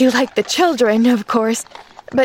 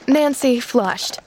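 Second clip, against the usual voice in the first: ordinary delivery, nothing marked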